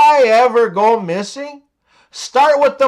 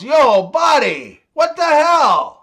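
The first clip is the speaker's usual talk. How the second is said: higher-pitched mock yell in a joke; mock Canadian yell; 6 semitones higher than usual. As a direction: call out in exaggerated disbelief. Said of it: "Canadian guy yells".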